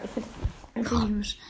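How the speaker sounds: soft unvoiced whisper. Real, not acted. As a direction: whisper it as a secret aside. Whispered aside about liking Naruto.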